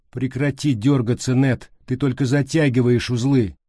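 urgent scolding call; sharp command. A narrator's reading. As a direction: order someone to stop struggling, high and forceful. Ordering struggling Ned to keep still.

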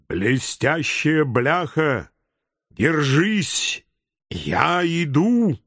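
slow, odd creature voice; a small turtle calling bravely; little turtle voice. Acted. Запыхавшаяся черепашка. (медленный голосок черепашки)

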